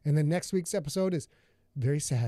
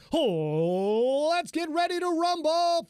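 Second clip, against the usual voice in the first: higher, drawn-out announcer call; ring announcer; 11 semitones higher, 3 dB louder, slower than usual. Pretend. Host kicks off story time, mock ring announcer. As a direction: bellow it like a boxing announcer. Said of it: "announcer catchphrase before story".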